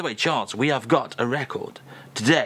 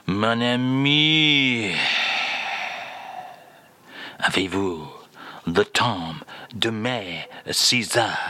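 slow, lower, drawn-out mock French; slow drawn-out French accent; 4 semitones lower, slower than usual. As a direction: stretch every word in a hammed-up French accent. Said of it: "read that out again for us".

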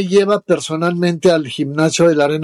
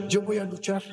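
much softer retold declaration; quietly restated resolve; hushed repeat; 3 semitones higher, 13 dB quieter than usual. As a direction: drop the volume right down.

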